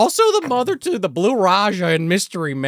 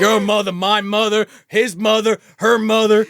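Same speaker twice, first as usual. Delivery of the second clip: loud, high list hammering "mother"; punchy mock announcement; emphatic roll call; 6 semitones higher, 5 dB louder than usual. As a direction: hit each item like a drinking-game call.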